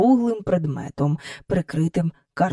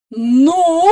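loud, higher-pitched boom; booming woman; 8 semitones higher, 6 dB louder than usual. A narrator's reading. Miss Andrew greeting the children with barely a smile, booming. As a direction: boom it out as a question. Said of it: "прогула вона".

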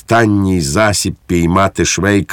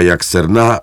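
plain reading voice

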